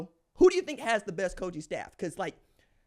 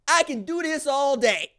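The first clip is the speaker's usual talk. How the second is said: Captain America quote; 8 semitones higher, 8 dB louder than usual. Retold or imitated. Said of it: "Captain America going".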